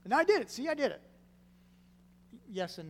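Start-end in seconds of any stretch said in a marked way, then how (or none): 0.0-1.0 high, loud burst of mock pride; pleased-with-yourself, pitched way up; self-congratulating. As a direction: crow about it like it's finished. Acting a one-time believer's boast.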